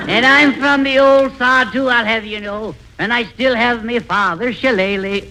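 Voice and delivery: dummy fakes an Irish brogue to a singer; mock Irish brogue. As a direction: boast in a put-on Irish brogue. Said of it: "Your Swedish accent is showing".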